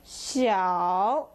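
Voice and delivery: "xiao" dipping low, then rising; third tone. Shown as a demonstration. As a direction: draw "xiao" down and back up. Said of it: "up and down".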